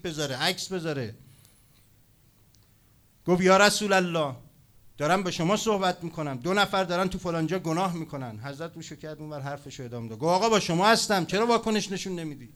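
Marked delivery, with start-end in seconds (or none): 3.4-8.4 high, loud, pressing appeal; acted insistent call. Insistent informer demanding attention, acted. 10.3-12.5 high, loud demand for attention; raised voice of a pushy informer; acted loud protest.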